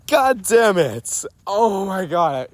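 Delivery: high, slow, drawn-out laughing curse. Real, not acted. Recording for Andrew, laughing at her tenth try. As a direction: laugh it out in defeat.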